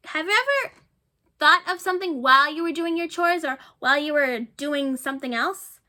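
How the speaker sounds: plain reading voice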